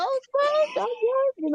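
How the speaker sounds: high squealing mock surprise; shrieking tease. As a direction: shriek it like gossip caught out. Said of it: "Y'all was like".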